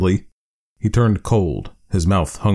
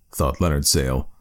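ordinary delivery

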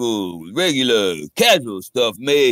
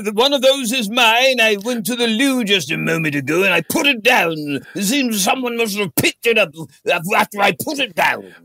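posh character voice; 7 semitones higher than usual. Acted. Improv character claims paddle.

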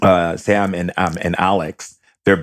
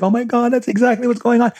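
much higher, squealing friend in tears; mock crying friend. Friend on the phone with his mom, squealing high in tears. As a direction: squeal it like a stunned, tearful friend. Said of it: "she's crying and she's like".

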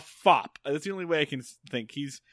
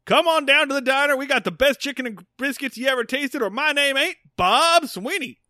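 high, loud hammed-up local-commercial announcer; mock small-town ad read; folksy TV ad pitch; 11 semitones higher, 6 dB louder than usual. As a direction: sell the diner like a cheesy local TV spot.

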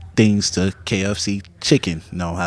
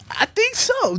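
high squeaky answer; slower than usual. Real, not acted. High unsure reply.

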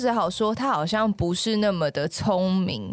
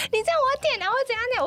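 agitated coworker; much faster than usual. Retold or imitated. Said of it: "然后他就整个说".

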